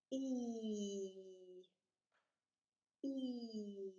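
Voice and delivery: E held as a steady, stretched ee; long vowel E. Shown as a demonstration. Teacher gives a listening task, E stretched out. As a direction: sustain the letter name E. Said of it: "I'm gonna say a long vowel sound".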